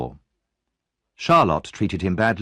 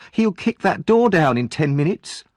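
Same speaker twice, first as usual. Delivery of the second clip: alarmed; 8 semitones higher than usual. Acted. Missus Sowerby alarmed.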